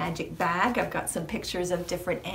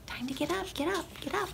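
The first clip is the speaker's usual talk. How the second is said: tiny, high, very soft cat voice; cats nudging; 9 semitones higher, 9 dB quieter, much faster than usual. Acted. Cats telling farmer to get up, small and urging. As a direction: pipe up quietly like a little cat. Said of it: "the cats are like".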